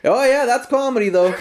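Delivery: high-pitched, laughing imitation; Mark Normand. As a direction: mimic the comedian's tagline through amusement. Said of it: "And you're just like".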